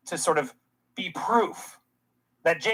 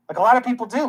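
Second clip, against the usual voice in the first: ordinary delivery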